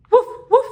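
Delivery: high, loud woof woof; dog bark. Pretend. Reading to children, a loud yappy dog bark.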